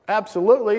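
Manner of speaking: high, loud firm answer; insist, leaving no room for argument; acted-out firm command; emphatic insistence. God's firm answer in story.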